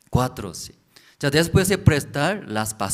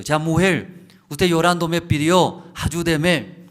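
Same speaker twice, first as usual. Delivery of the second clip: louder and higher prophet's reply; 5 semitones higher, 6 dB louder than usual. Acted. Prophet reminding the crying widow of her plea, raised voice. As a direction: call out to her, raised and emphatic.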